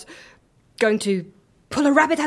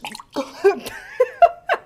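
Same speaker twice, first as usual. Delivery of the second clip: high burst of disbelieving laughter; incredulous laugh. Real, not acted. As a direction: laugh at the botched trick. Trick revealed as wrong.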